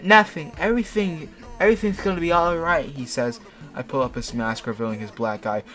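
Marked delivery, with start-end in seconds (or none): 0.0-2.8 loud, high put-on voice; reassuring character voice. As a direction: play the panicky rescuer insisting all is well. Voicing 2D reassuring the screaming narrator.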